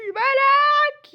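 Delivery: long, loud, high drawn-out call. Acted. Colleague tries to get Malak's attention, long loud yell. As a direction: stretch the name into a yell. Calling Malak, who ignores her.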